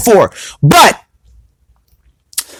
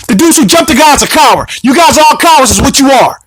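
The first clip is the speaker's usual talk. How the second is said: heated, higher-pitched scolding; indignant rant; 5 semitones higher than usual. Real, not acted. Commentator calling out a rapper for jumping a guy, indignant. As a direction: lay into him in a high, insistent voice.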